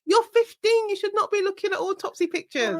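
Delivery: high-pitched mock scolding; incredulous telling-off, pitched well up; retold appalled disbelief. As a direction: play shocked at what a teenager is seeing. Retells her shocked thought at the kid.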